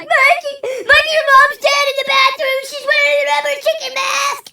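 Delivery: very high, loud sobbing-laughing panic; frantic hysterical yell. Retold or imitated. Tripping man yelling at a ten-year-old about his mom, hysterical high shout. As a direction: blurt it out like a madman to a kid.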